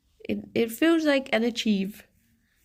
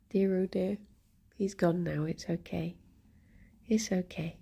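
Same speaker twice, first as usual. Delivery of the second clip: softer, relieved murmur; relieved hush; 7 dB quieter than usual. Real, not acted. Relieved murmur to viewers once the man is gone. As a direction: let the tension out quietly.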